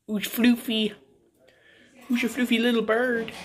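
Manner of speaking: high, doting pet voice. Real, not acted. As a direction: coo over a fluffy little bird. Baby talk to pet bird.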